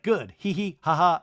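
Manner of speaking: put-on, awkward little laugh syllables; mock awkward chuckle. Retelling an awkward exchange, mock awkward laugh. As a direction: imitate forced laughter. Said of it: "it's just more awkward".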